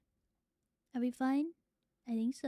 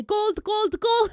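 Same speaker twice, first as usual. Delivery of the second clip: building to a high, loud yell; shouting; 7 semitones higher, 9 dB louder, much slower than usual. Pretend. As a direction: chant it, louder each time. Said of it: "he's about to say".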